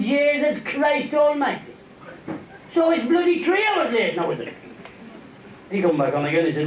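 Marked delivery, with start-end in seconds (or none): 0.0-1.7 very slow, higher drawn-out oath; long-suffering passenger's exasperated oath; comic exasperation. Acted. Paddy reacting to a longer delay. 2.7-4.5 high-pitched put-out passenger; aggrieved, higher-pitched grumble; comic indignation. Acted. Paddy complaining about the longer delay.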